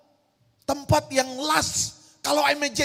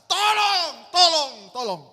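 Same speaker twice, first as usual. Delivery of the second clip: "Tolong" yelled high and louder; the crowd screaming for help, loud; loud cries; 5 semitones higher, 4 dB louder, slower than usual. Shown as a demonstration. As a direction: shout for help over and over. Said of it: "Suaranya keras berkata".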